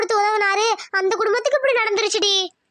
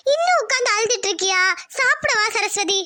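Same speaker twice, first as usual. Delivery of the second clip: ordinary delivery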